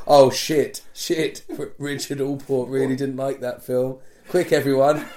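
loud, flustered studio boss in a sudden flap; hammed-up alarm, calling everyone to action; mock panic. Hollywood bosses panicking over a bad review, loud mock panic.

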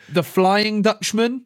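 slow, high dramatic announcement; mock grand announcement; grand build-up. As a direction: unveil the name like a reveal. Announcing the player with flair.

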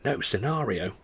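hushed, very soft aside; mouthed. A narrator's reading. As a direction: mouth it almost silently to a friend. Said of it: "Charlie turned to me and mouthed".

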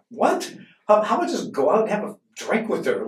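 high-pitched, baffled retort; incredulous. Retold or imitated. Teenager answering friends who would buy a girl a coat, incredulous. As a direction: jump high on the first word in mock disbelief.

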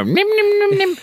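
very high squeaky nibbling noise; imitated rabbit munching, squeaked fast; rabbit puppet nibbling. Host mimics the rabbit puppet, high nibbling squeak. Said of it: "the rabbit puppet go".

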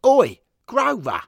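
loud, high, drawn-out hooligan shout; interrupting yell. Acted. Hooligan steward interrupts Henry and Johnny, interrupting yell. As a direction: bellow for attention.

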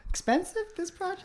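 sarcastic disbelief, pitch way up; mock surprise. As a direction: feign shock, voice jumping high. Teasing about the costly shoot.

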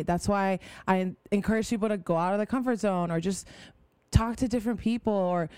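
ordinary delivery

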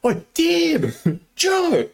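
very high-pitched holler to two friends; Jake. Retold or imitated. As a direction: imitate calling across a crowded campus. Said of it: "a hundred and fifty meters away".

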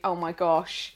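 ordinary talk

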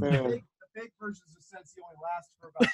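distant, off-mic voice; calling in from the next room; from another room. Real, not acted. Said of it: "Talking from the other room".

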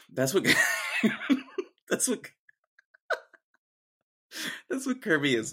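breaking into laughter mid-sentence; too tickled to get the line out, loud laughing bursts; cracking up. Real, not acted. Cracking up at own Kirby joke.

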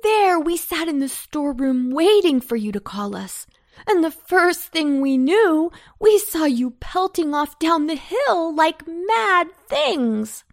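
high, loud, aggrieved outburst; panting indignation. A narrator's reading. Cabbages scold those who left them, panting indignation. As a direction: complain loudly at being left behind. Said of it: "panted the cabbages".

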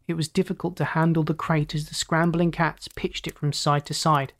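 plain reading voice

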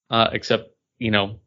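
ordinary delivery

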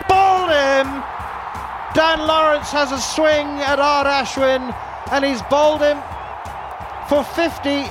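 excited shout. Real, not acted. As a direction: shout the final dismissal. Final wicket called live.

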